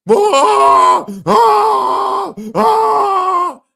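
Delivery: high piercing yells of protest; loud yells. Real, not acted. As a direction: shout over the interview. Crowd yelling in clip.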